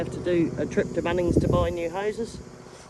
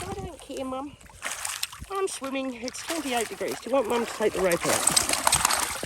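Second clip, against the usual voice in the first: carefree dog; 7 semitones higher than usual. Acted. Owner voices her swimming dog, high and carefree. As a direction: answer back lightly as the swimming pet. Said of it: "She says".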